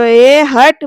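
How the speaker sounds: loud, irritated brush-off; annoyed snap. Retold or imitated. Surya shoving off his two friends, annoyed outburst. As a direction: snap at them to back off. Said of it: "Surya चिडून म्हणतो".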